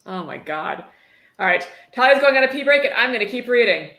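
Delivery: ordinary talk, nothing marked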